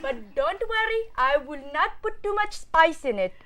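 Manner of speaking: reassuring mock Indian accent; put-on Indian accent. Student reassuring guests about spice, mock Indian accent. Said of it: "I will do the next accent".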